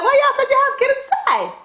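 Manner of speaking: high scolding mother, pitched well up; mother's voice. Retold or imitated. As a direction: play the bossy mom, pitched high. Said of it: "She's like".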